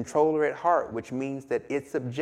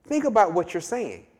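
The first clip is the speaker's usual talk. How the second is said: scorpion talking the frog round, pitched higher; persuading scorpion; 8 semitones higher than usual. Acted. Scorpion persuading the frog to carry him across. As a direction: coax him, it makes perfect sense. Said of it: "And the scorpion says".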